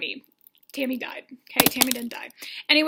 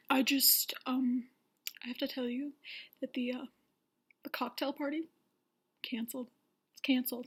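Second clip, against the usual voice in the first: very soft, halting delivery; mock grave announcement; 9 dB quieter than usual. Host telling the women the party's canceled, mock grave. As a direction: break bad news quietly with long pauses.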